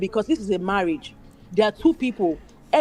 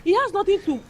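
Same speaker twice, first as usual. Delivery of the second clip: higher, exasperated questioning; incredulous retort; 8 semitones higher than usual. Real, not acted. A woman scoffing at fans who call him a gentleman, incredulous and higher. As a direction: throw the question back in disbelief. Indignant retort to fans' claim.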